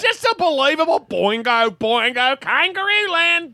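put-on high, over-the-top Australian accent; hammed-up, badly faked Australian; mock Australian accent. Comic pretending to be listener Andrew, mock Australian accent. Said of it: "You can do it in an Australian accent".

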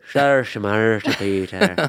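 mock Irish gibberish; Irish accent. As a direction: ham up an Irish brogue. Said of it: "that's my Irish accent".

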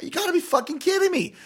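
a stunned, shrill-free but high "you gotta be kidding"; outraged disbelief. Retold or imitated. Retold disbelief.

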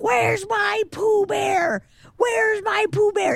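put-on very high, slow, shouting old man; old man wailing. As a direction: wail it like a lost old man. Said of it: "Old Man's like".

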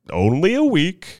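high, soothing showman voice; calming crowd of ladies. A narrator's reading. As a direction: pat the air with your voice. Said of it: "put up his hands to pat the air".

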